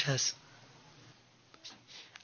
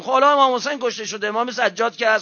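higher rebuking sermon voice; reproachful preaching; 8 semitones higher than usual. Real, not acted. Preacher rebuking the Kufans, higher and reproachful. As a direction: scold the faithless from the pulpit.

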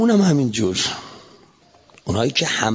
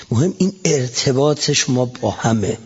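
normal talking voice

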